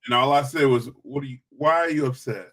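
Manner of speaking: usual speaking voice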